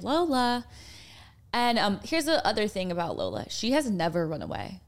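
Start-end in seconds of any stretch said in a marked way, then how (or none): 0.0-0.6 mimed call for a lost dog; high, drawn-out calling of a name. Shown as a demonstration. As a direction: call the dog's name, pitched up without real shouting. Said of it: "so you can't be like".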